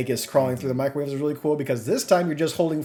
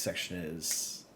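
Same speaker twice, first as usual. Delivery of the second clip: much softer, low and slow; hushed awe; 8 semitones lower, 14 dB quieter than usual. Real, not acted. Host teasing the next section, soft and slow. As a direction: savour it under the breath. Closing praise, trailing soft.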